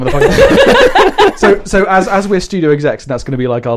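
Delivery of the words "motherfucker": drawn-out laughing yell; hyped shout. Real, not acted. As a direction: stretch the last word, laughing. Host repeats hype intro.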